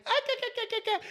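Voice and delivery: very high put-on cackle; squeaky mocking laugh noises. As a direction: imitate snooty chatter as high "ka-ka" noises. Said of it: "they're all kinda like".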